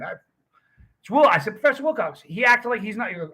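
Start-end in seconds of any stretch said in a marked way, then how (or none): 1.6-2.2 insistent recognition. Retold or imitated. Calls old professor again.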